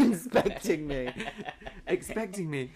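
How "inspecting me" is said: laughing. Real, not acted. Laughing mishearing joke.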